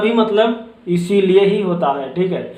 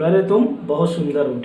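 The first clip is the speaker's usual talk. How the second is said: ordinary talk, nothing marked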